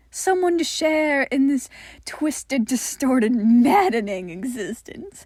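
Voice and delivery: sinister creature tender; fond eerie entity. Acted. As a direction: speak lovingly of the twisted bond. Michael fond to Helen.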